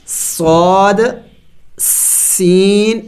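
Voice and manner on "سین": سین drawn out very slowly with a hissing whistle on the س; slow sustained hiss on سین; whistled s. Shown as a demonstration. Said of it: "منہ سے سیٹی کی آواز نکلنی چاہیے".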